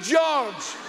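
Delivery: ordinary talk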